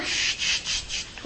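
soft hissed shh to hush them; quiet them with a hushing shh; shushing. Real, not acted. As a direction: softly shush the sisters in the masjid. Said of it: "We are learning the Arabic of the Quran".

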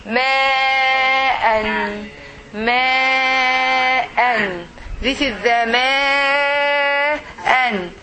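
"Ma'an" with a very long first vowel, then a short "an"; drawn-out vowel. Shown as a demonstration. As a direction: hold the "ma" of "Ma'an" long and steady. Teacher models a Quran word slowly.

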